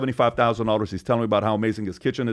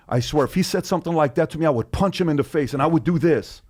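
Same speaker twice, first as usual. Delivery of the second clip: higher, hammed-up tough-guy threat; mock tough guy; 6 semitones higher than usual. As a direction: puff up like a guy bragging he'd fight. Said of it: "Brian Callen says".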